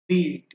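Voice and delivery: বিট with a loud, drawn-out ee, as in "beat"; long ee. Shown as a demonstration. Teacher repeats the vowel contrast, long-ee বিট. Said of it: "উচ্চারণটা দীর্ঘ ই এর মতো".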